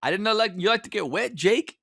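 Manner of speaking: high, sing-song teasing cop impression; Denzel Washington. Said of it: "Denzel just loving it".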